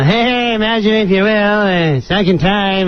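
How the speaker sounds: offhand, noncommittal run-through; half-hearted rehearsal. Retold or imitated. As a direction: imitate an actor not bothering with the impression. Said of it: "you don't even sound like him".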